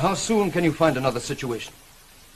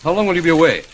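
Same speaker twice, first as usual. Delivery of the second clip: urgent demand; 3 semitones higher, 9 dB louder than usual. Acted. Master pressing the departing governess.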